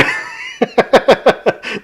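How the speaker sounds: airy unvoiced burst of laughter; breathy laugh. Real, not acted. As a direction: laugh out, almost silent. Laughing at Rick's struggle.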